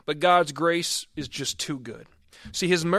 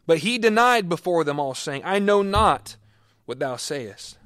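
raised, emphatic Bible reading; dramatic scripture reading; 5 dB louder than usual. Acted. Pastor reading Matthew to his congregation, raised and emphatic. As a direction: proclaim the verses like a preacher.

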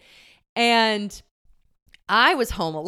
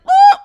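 a tiny high shriek, much higher and louder; mouse's squeal; 14 dB louder than usual. Retold or imitated. Voicing the startled mouse as she bursts in, squealing high and loud. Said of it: "He was like".

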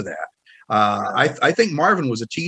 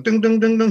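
high sung ding ding ding notes; imitating a piano note test; 8 semitones higher than usual. Imitating Marvin testing the pianos, sung dings. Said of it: "he was going".

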